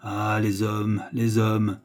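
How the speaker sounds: slow, understated despairing sigh of words; mild despair. A narrator's reading. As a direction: lament it slowly, with restrained hopelessness. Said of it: "il vous dit bientôt avec désespérance". (désespérance contenue, lente)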